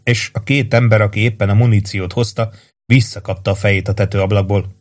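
normal talking voice

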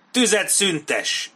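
high, loud, drawn-out officer's yell; bellowed command. A narrator's reading. Colonel orders his men to cease fire, bellowing. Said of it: "üvöltötte Dodd ezredes".

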